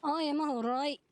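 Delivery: lightly put-on Irish accent; a weak stab at an Irish accent. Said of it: "my Irish accent is terrible".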